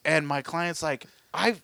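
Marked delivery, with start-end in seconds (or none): 1.3-1.6 high, indignant acted-out complaint; exasperated imitation, pitched up but not shouted; mad client. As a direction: protest like a customer who paid and got nothing. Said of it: "He's all mad and shit".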